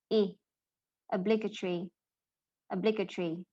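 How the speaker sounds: teacher's demo of "obligatory" with "blig", not "blige"; short i in obligatory. Shown as a demonstration. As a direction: isolate a short "ih", then "obligatory" stressed on that second syllable. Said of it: "pronunciation will no longer be like".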